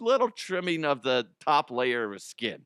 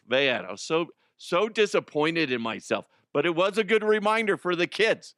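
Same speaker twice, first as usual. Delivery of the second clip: normal talking voice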